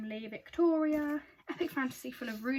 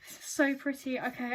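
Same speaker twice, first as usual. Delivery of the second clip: ordinary talk, nothing marked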